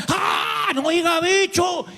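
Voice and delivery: high, startled shout of a shocked Puerto Rican listener; alarmed protest. Retold or imitated. Puerto Rican listener cuts off the preacher, shocked and shouting. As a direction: blurt it out in alarm. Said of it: "he estado en Puerto Rico".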